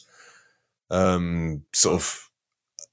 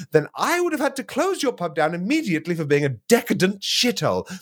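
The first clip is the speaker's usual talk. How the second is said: indignant chairman; 9 semitones higher, faster than usual. Acted. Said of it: "reenact it with you two".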